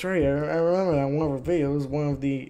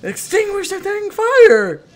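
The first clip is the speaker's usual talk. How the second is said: high, slow, drawn-out shout; fed-up yelling command; exasperated yell; 8 dB louder, slower than usual. Real, not acted. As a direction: scream it at useless sims. Frustrated at sims ignoring fire.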